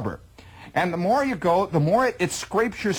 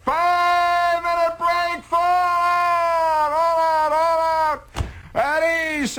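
hollered commands; 11 semitones higher, much slower than usual. Retold or imitated. Sergeant shouting a break to the tired column, high and slow. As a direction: stretch the orders out like a drill sergeant. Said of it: "And then he'll holler".

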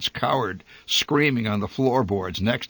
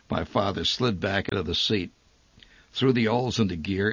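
usual speaking voice

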